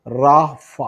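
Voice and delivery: റാഫ loud, long raa then fah; pronunciation demo. Shown as a demonstration. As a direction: say റാഫ firmly, both syllables clear. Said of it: "പ്രൊനൗൺസിയേഷനാണ്". (റാഫ ഉറക്കെ, വ്യക്തമായി ഉച്ചരിച്ച്)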